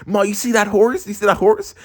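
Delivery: high, eager acted question; urgent asking. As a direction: point it out to Ma excitedly. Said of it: "I was like".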